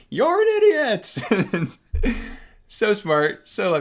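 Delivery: high, loud echo taunt. Retold or imitated. The echo taunting the Grinch, high and loud. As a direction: throw it back like a mocking echo. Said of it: "the Echo goes".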